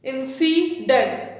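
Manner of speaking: इंसिडेंट slowly in three parts, in-ci-dent; split into syllables. Shown as a demonstration. Teacher showing three divisions in इंसिडेंट, slow syllable by syllable. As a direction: separate each syllable of इंसिडेंट. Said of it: "three syllable division".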